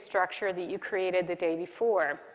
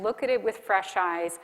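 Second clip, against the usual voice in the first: ordinary talk, nothing marked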